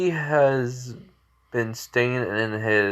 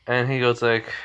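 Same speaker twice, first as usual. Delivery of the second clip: plain reading voice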